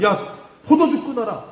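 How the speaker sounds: slow, high mock rebuke, a priest chiding; scolding shout. The priest Eli scolds Hannah, thinking her drunk, slow loud rebuke. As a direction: bellow it out slowly like a stern priest. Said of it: "막 호통을 치는 거예요".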